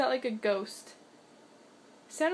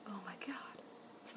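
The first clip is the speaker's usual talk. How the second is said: much softer, breathless whisper; 6 semitones lower, 19 dB quieter than usual. Real, not acted. Owner sees the cat spot another cat, hushed whisper. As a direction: whisper it, awed.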